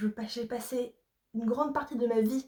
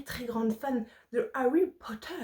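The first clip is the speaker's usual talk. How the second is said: a deliberately bad English accent on the name; mock English accent. Shown as a demonstration. Telling viewers she's a big Harry Potter fan, in a hammed-up English accent. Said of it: "Avec mon accent anglais bien pété".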